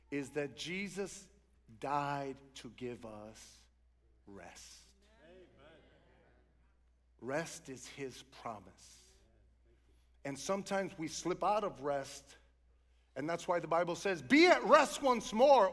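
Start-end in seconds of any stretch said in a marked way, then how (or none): 0.1-8.6 grave, hushed pulpit delivery; solemn preaching. Real, not acted. 14.2-15.7 loud, high declamation of a verse; sudden raised preaching voice; proclaimed scripture. Real, not acted. Proclaims a Bible verse aloud.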